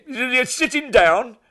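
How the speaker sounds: high, slow, teasing question; high slow manager. Retold or imitated. Manager teasing before the news, high and slow. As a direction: stretch it out high and slow to build suspense. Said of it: "he phones up".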